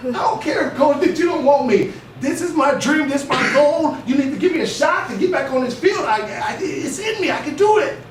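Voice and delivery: loud, high, insistent pleading to the coach; pleading shout. Retold or imitated. Arguing with the coach.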